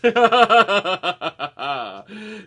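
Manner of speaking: long, loud cackling laugh. Real, not acted. Host joking about his abnormal accent, laughing loudly. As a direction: burst out laughing at your own joke.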